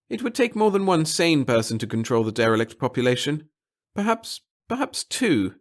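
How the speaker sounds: high, nervous little official; a fussy, timid bureaucrat. Acted. Pettigill mumbling, musing.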